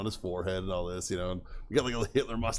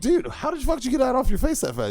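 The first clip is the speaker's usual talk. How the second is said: much higher amazed question; incredulous; 4 dB louder than usual. Retold or imitated. Asking the buddy how he cleaned up, amazed. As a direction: sell total amazement. Asking about removed Sharpie.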